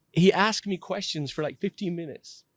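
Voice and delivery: usual speaking voice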